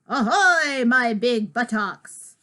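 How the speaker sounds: loud, hammed-up pirate shout. Cheering up crying George, hammed-up loud pirate. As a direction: bellow like a silly pirate. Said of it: "I talk like a pirate".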